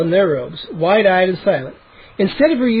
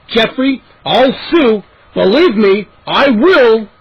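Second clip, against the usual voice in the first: threatening; 4 semitones higher, 5 dB louder, slower than usual. Acted. Neighbor threatens lawsuit.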